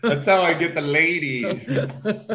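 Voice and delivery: slow, high, laughing brag; laughing joke. Real, not acted. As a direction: joke while cracking up. Son jokes about ladies.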